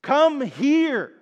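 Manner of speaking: a loud, high, urgent shout of "Come here!"; impassioned yell. Retold or imitated. Parent yelling at a child in the street, urgent shout. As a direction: yell it like your child is in the road. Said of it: "you would yell impassioned".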